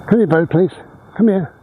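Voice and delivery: high coaxing dog call. Real, not acted. Owner calling her dog back to her, high coaxing call. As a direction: pitch up to call him back.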